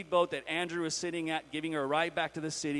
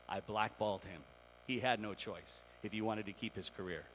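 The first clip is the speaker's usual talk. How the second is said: low, soft admission of guilt; subdued, lowered voice, owning the blame; solemn confession; 6 semitones lower, 6 dB quieter than usual. Acted. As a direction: confess it quietly and gravely to the whole crowd. Acts her confession at the altar.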